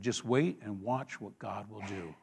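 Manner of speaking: very low, soft counsel; hushed, patient reassurance; calm assurance. Acted. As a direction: quietly tell them to trust. Voices Moses counselling patience.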